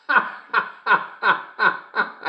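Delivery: high, rolling cackle; gleeful laugh. Real, not acted. Host bursting out after the drowning joke, gleeful cackle.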